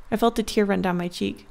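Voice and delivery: ordinary delivery, nothing marked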